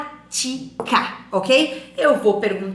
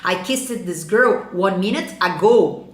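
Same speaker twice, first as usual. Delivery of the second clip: slow, deliberate English demo; slow model sentence; 3 semitones lower, much slower than usual. Shown as a demonstration. Teacher models the past tense slowly for the class. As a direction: lay each word out slowly. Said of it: "você vai falar".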